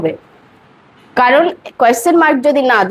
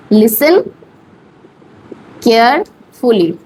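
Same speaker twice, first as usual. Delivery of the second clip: Listen and carefully said slowly with a long pause between; teacher spacing out each word for the class; 2 semitones lower than usual. Shown as a demonstration. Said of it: "আস্তে আস্তে বলুন".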